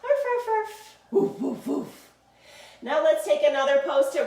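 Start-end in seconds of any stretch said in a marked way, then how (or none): 0.0-2.1 playful imitation barking; puppy sounds. Shown as a demonstration. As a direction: bark like a puppy for the children. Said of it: "make your puppy sounds".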